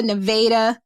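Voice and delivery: Nevada with long a as in "day"; alternate pronunciation. Shown as a demonstration. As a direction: say Nevada as nuh-VAY-duh. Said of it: "I've heard Nevada pronounced so many ways".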